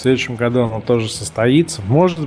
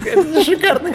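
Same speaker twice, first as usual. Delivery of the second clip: high, laughing delight. Real, not acted. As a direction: laugh through the words. Laughing at the shock joke.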